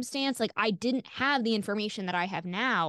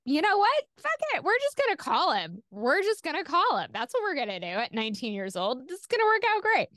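much higher, brash and defiant; reckless resolve; 6 semitones higher than usual. Retold or imitated. Nineteen-year-old deciding to call the doctor, brash and high. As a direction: talk yourself into it, cocky and high. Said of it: "I kind of just decided to, like".

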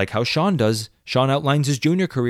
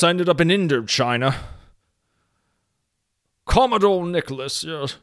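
pompous British commodore; 5 semitones higher than usual. Acted. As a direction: play a puffed-up old British sea commodore reminiscing. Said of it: "I'm gonna save that character".

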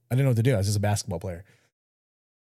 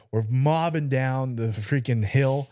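usual speaking voice

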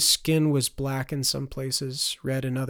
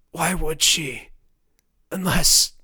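slow, high, parched rasp; dying of thirst; 4 semitones higher, 7 dB louder, slower than usual. A narrator's reading. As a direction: trail into a thought with a dry, strained throat. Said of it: "sounded like a man dying of thirst".

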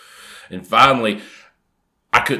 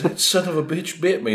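mock outrage, high-pitched; outraged friend, high; 9 semitones higher than usual. Friend bitten on the tongue, high-pitched outrage. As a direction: squeal it like you were bitten. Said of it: "spits it out and goes".

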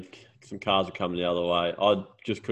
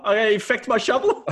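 much higher, laughing through the repeat; laughing punchline; 13 semitones higher, 5 dB louder than usual. Retold or imitated. Retelling the farmer's line to a mate, laughing. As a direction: crack up while repeating the quote.

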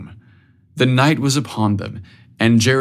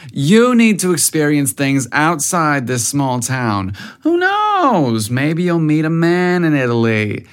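high, dreamy mother gushing about her husband; mom's voice; 4 semitones higher than usual. A narrator's reading. Mother teasing son about Italian men, high and dreamy. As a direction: swoon over your handsome husband. Mom voiced in read story.

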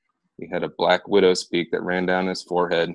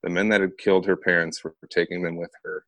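usual speaking voice

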